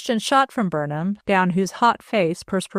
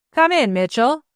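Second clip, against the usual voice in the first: louder, higher call to the door; called more loudly; 6 semitones higher, 5 dB louder than usual. A narrator's reading. Sick man calls the detective in, loud call. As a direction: call him in across the room. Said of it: "he called more loudly".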